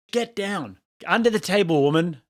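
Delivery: exasperated pretend command; mock urgent command. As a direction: pretend to order your wife under cover. Acts husband ordering wife under table.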